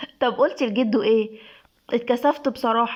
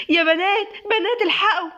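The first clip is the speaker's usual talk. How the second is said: put-on high-pitched, urgent call to the girls; excited calling out; 7 semitones higher than usual. A narrator's reading. Shahd runs in calling the girls, high excited call. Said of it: "شهد جاية تجري".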